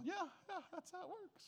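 high, soft knowing mom voice. Retold or imitated. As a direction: agree like a seasoned mom. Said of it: "All you moms out there go".

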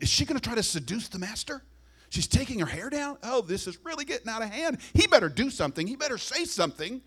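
high, fretting disbelief; scandalised alarm. Retold or imitated. Guests at Simon's house fretting, high and scandalised. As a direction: squeal it like a shocked onlooker.

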